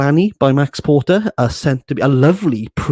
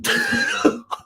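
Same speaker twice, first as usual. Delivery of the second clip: short high squeaky laugh; high squeal of laughter; 3 dB quieter than usual. Real, not acted. Laughing at the book-haul joke, high squeal. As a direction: let out a high giggle burst.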